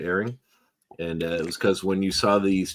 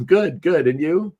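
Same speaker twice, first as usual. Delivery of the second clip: high-pitched, louder mock soundboard reply; Arnold Schwarzenegger; 9 semitones higher, 5 dB louder, slower than usual. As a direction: imitate the movie star in a prank call. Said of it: "just talking as Arnold".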